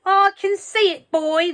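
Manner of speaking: put-on high, loud old woman's answer; shouted back. A narrator's reading. Grandma shouting back to George. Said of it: "the old girl shouted back".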